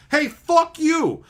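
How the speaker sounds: very high, loud and slow mock voice; hammed-up sneer. Imitating newcomers taunting old players, very high and slow. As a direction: stretch it out like a taunting brat.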